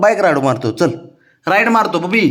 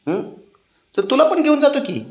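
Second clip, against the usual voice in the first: much higher, wheedling sing-song; pitched-up pleading with a pouting pet; high coaxing; 9 semitones higher, slower than usual. Real, not acted. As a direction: wheedle him up with a lilt. Coaxing sulking pet to come.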